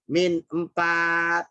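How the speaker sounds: ordinary talk, nothing marked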